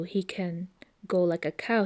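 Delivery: ordinary delivery, nothing marked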